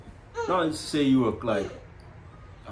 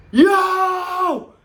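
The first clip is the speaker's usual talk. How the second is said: high, loud startled yell; shocked cry; 8 dB louder than usual. Retold or imitated. Husband reliving the moment he saw the baby, loud startled yell. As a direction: yelp it in shock.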